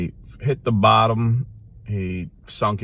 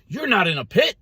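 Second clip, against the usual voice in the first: high, insistent mock denial; hammed-up positive confession; Word of Faith brother; 10 semitones higher, 3 dB louder than usual. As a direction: declare it away in a raised pitch. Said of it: "a Word of Faith brother walked by and said".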